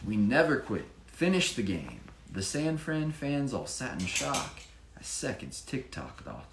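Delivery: sing-song picture-book narration; rhyming story reading. A narrator's reading. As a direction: read the rhymes to a child with energy. Reads rhyming story aloud.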